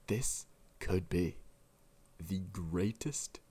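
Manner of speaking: lightly voiced solemn broadcaster; Will Buxton impression. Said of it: "the Will Buxton just creeped me out".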